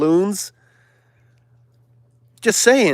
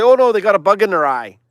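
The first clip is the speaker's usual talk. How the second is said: fast, high, loud mock excuse; mocking quote; 6 semitones higher, 5 dB louder, faster than usual. Sneering at the stars' excuse, mocking and fast. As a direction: rattle off the lame excuse sarcastically.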